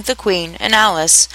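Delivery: plain reading voice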